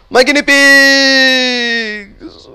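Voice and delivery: high, loud, slow drawn-out cry of loss; real despair; anguished wail. Real, not acted. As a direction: wail over the lost pets. Pets killed by explosion.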